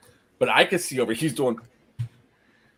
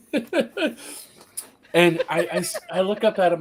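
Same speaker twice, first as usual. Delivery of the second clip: real laugh breaking into the words; 7 semitones higher, slower than usual. Real, not acted. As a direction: start talking through high-pitched laughter. Cracks up at own story.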